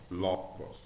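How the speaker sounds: "logos" with LO leaning heavier; stronger accented syllable. Shown as a demonstration. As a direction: stress the first syllable of "logos". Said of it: "a gente pronuncia mais forte".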